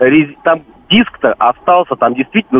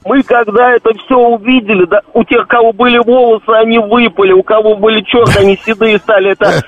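excited; 7 semitones higher than usual. Real, not acted. Exaggerated shocked retelling.

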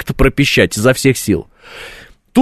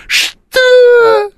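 high, shocked shriek; outraged shriek. Retold or imitated. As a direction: screech it in disbelief. Outraged at vacation offer.

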